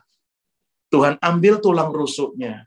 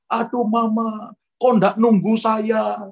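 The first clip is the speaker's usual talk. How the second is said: higher, drawn-out mock lament; imitated wailing cry; 6 semitones higher, slower than usual. In-laws crying out at mama's body, mock wail. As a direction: mimic a grieving wail. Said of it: "ipar-ipar saya teriak".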